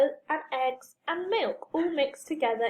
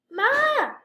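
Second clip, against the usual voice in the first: high, loud call; shouted; 10 semitones higher, 5 dB louder than usual. A narrator's reading. Tom calling his mother from outside, loud high shout. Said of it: "shouted Tom".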